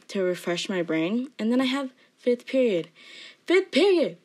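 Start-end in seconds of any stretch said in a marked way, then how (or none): 3.5-4.2 higher, louder outburst; dramatic exclamation. Real, not acted. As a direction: exclaim it with theatrical exasperation. Exclaims the same class name.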